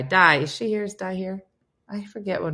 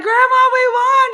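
very high, loud excited cry; 12 dB louder than usual. Retold or imitated. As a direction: shout the big news in triumph. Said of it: "I remember being so excited".

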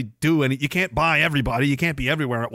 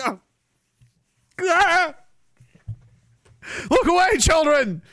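hammed-up shriek, covering children's eyes; mock panic; 12 semitones higher than usual. Mock panic at people in street.

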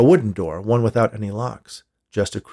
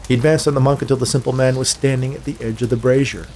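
usual speaking voice

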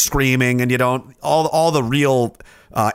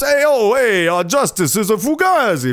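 high, slow wiseguy voice; mobster; 8 semitones higher, slower than usual. Acted. Reading the viewer's comment as a wiseguy, slow and high. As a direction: play a New Jersey tough guy.

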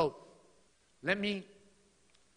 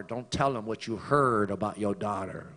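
usual speaking voice

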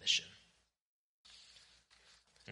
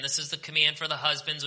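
ordinary talk

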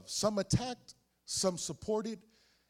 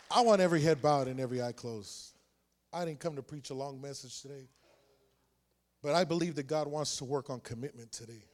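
altar call; 3 semitones lower than usual. Real, not acted. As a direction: lower the voice and invite them to bow. Altar call closing prayer.

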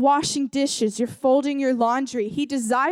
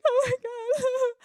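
high, drawn-out mock wail; hammed-up tearful outburst; imitated crying; 12 semitones higher than usual. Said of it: "starts breaking down crying".

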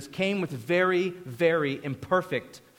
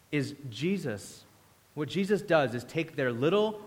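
ordinary delivery

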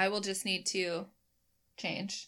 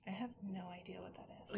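fast, very soft whisper; hushed aside; 14 dB quieter, much faster than usual. Real, not acted. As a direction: whisper it quickly to your co-host. Off-mic aside about a noise.

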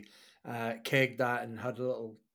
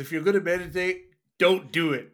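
mock announcement; 4 semitones higher, 8 dB louder than usual. Real, not acted. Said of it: "Message to our listeners".

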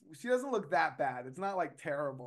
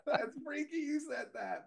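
laughing; 11 semitones higher, 4 dB quieter than usual. Real, not acted. Still laughing at the coincidence.

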